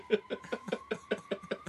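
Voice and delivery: breathless squeaky laugh; high wheezing laugh. Real, not acted. Wheezy laugh telling his mate about his tweet. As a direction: laugh silently up high at his own cheek.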